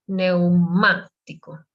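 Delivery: neumático slowed, with the weight on má; stressed má. Shown as a demonstration. Teacher repeats neumático slowly, stressing má. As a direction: lean on the má of neumático. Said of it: "you see the accent here".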